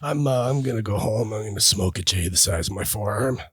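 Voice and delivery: lazy and baked, half laughing; stoner voice. Pretend. Stoner voice game, his turn.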